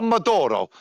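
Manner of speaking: Pomodoro dit à l'italienne, haut et appuyé; Italian pomodoro. Shown as a demonstration. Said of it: "change the name".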